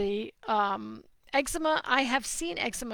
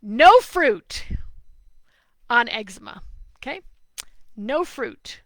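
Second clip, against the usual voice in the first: emphatic; 8 dB louder than usual. Real, not acted. Heated advice to a parent.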